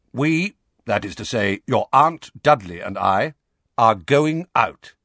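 pompous uncle. Acted. Uncle Vernon spelling it out.

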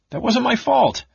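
wounded pride, defend yourself; indignant cry. Acted. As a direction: Tas protesting the blame for his map. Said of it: "Tas cried indignantly".

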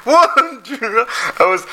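squeaky high laughter through words; words lost in a giggle fit; laughing. Real, not acted. Cracking up with friends on the podcast, high laughing.

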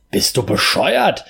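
slow, mildly yelled insult; a hint of shouting; mock yell. A narrator's reading. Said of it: "begann ich wieder zu schreien".

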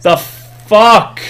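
stunned disbelief at the plot. Real, not acted. Ranting about the movie.

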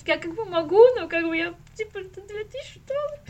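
tearful whine, hammed up and laughing; mock crying. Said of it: "у меня так слеза просто катится". (шуточный плач сквозь смех)

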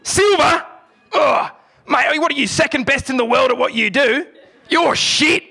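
mock the silver medal like a let-down Australian fan; disgusted Aussie fan. Australian fan scolding silver medal athlete, scornful Australian bloke. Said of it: "someone who's like".

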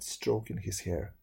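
plain reading voice

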